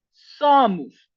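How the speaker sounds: "somos" said as SO-moos; final O as U. Shown as a demonstration. Teacher demonstrating to students, "somos" ending in oos. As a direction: say "somos" with its last O as oo. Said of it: "suena como una U".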